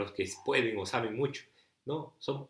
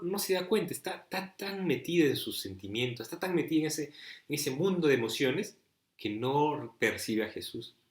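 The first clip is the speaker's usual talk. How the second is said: ordinary talk, nothing marked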